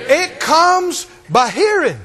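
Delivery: slow, weighted, each word landed; slow preacher emphasis; slow emphatic answer. Acted. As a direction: deliver the answer slowly.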